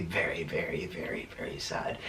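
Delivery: drops to a whisper on the very's. Real, not acted. Confessing how lost she'd be without him, whispered. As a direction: breathes the words out, barely voiced.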